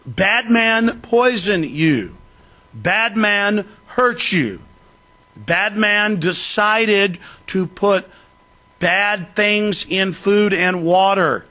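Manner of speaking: put-on slow, high, talking down like to a child; mock baby-talk explaining; condescending slow talk. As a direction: spell it out slowly as if to a simpleton. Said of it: "that's how they talk to you".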